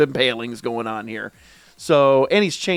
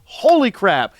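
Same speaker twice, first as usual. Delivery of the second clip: hyped; 7 semitones higher, slower than usual. Real, not acted. Hyping the new manga chapter.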